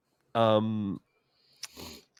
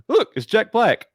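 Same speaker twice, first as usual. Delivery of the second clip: excited; 11 semitones higher, 6 dB louder than usual. Retold or imitated. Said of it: "I was like".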